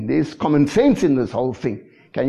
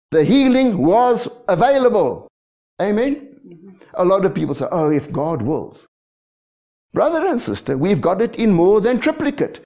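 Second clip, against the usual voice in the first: preaching at full force; 7 semitones higher than usual. Real, not acted. Preacher to congregation, emphatic high preaching. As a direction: drive the point home to the crowd.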